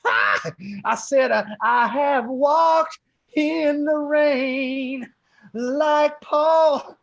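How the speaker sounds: loud, high, slow preaching with "ah" between phrases; hammed-up revival sermon, shouted; hellfire preacher. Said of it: "It's more like that".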